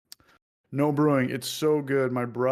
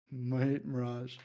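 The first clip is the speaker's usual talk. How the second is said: slow, softer mock-Aussie mate; mock Australian; 5 dB quieter, much slower than usual. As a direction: drawl it out like your Australian buddy. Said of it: "always calls me mate".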